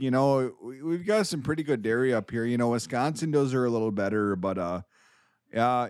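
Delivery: hammed-up folksy Minnesotan accent; mock Minnesotan accent, rambling and polite. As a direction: chat amiably in a put-on Minnesotan accent. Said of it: "a correct Minnesotan accent".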